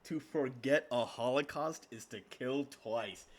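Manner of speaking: slow, drawn-out awe at a saying; savouring a quote. Real, not acted. Reactor repeats the video's closing quote, slow and impressed. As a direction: let each word of the saying stretch out.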